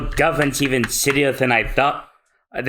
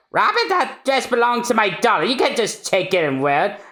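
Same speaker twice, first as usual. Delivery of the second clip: higher nagging voice; scolding aunt; 7 semitones higher than usual. Acted. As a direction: scold the child over the dress.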